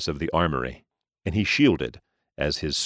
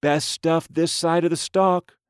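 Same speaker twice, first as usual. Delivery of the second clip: street dealer; 11 semitones higher than usual. Acted. Dealer's sales pitch.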